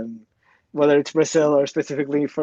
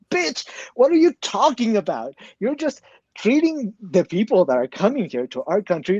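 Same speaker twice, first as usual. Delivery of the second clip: high-pitched, exasperated comeback; indignant retort; 6 semitones higher than usual. Retold or imitated. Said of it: "And I'm like".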